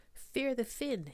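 high, loud mocking sneer; a jeering shark line. A narrator's reading. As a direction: taunt them like a bully. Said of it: "he sneered".

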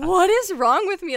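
high and loud despairing question; breakdown cry. Retold or imitated. Mid-breakdown, asking herself, high despairing cry. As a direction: ask it near tears. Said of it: "a mental breakdown".

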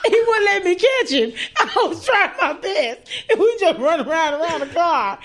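laughing hard while telling the chase; can barely get through it for laughing. Real, not acted.

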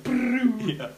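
high, loud buzzing brrr imitating the toaster; mock rattling brrr. Friend retells the Pop-Tarts scene with a high rattling brrr. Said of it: "he's like".

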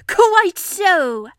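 high, loud show of bravery; extra brave. Acted. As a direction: declare it boldly, bucking yourself up. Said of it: "still more bravely".

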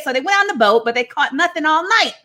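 normal talking voice

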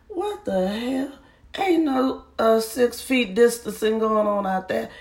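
high, incredulous squeal of disbelief; shocked outburst. Retold or imitated. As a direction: shriek it up high like you can't believe your eyes. Retelling her shocked reaction to video.

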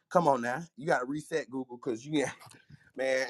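normal talking voice

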